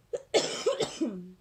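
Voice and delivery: rough hacking cough, real and loud; a raspy smoker's cough mid-sentence. Real, not acted. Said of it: "Excuse me, a smoker's cough".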